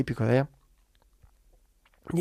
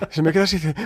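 normal talking voice

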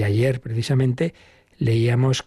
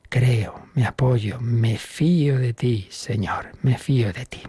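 slow prayer; slower than usual. Shown as a demonstration. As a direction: pray it unhurried, meaning every word. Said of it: "decirla despacito, meditar cada palabra".

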